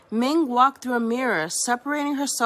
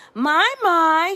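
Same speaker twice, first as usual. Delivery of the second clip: loud, higher angry roar; 6 semitones higher, 7 dB louder than usual. Acted. Giant panda mother roaring at daughter, loud. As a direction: roar the name from above. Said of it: "she roared".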